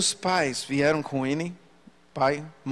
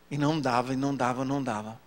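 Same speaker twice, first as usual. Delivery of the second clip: each repeat softer and lower; fading; 6 semitones lower, 5 dB quieter, faster than usual. Retold or imitated. Preacher recalling he could not speak, fading out. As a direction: let it sink away into defeat.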